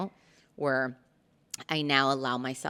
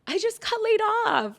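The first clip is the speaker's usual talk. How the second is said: much higher, wailing; high wailing confession; 5 dB louder than usual. Retold or imitated. Daughter tells her father the news, high and wailing. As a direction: wail it out. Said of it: "And I'm like".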